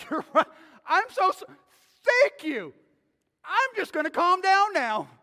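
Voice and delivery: loud, high, hammed-up gushing; mock grateful wife. Pastor mocks a wife's impossible reply, loud and high, hammed-up. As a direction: overplay a wife suddenly grateful for being told to calm down.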